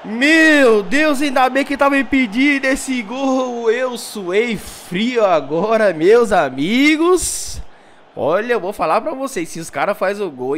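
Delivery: lively football commentator calling the action; play-by-play commentary. Acted. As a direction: call the match like a TV announcer. Live match commentary in game.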